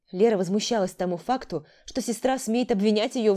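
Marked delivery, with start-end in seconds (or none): none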